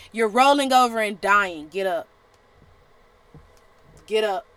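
a preacher rallying the viewer, loud; urgent command. Real, not acted. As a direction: push them to stand up. Preacher urging.

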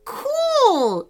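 high, drawn-out cry of delight; excited exclamation. Acted. Archie learns he is inside the game, excited exclamation. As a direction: exclaim it like a thrilled kid. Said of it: "exclaimed Archie".

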